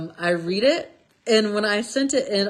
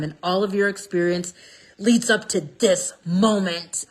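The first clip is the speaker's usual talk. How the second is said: ordinary talk, nothing marked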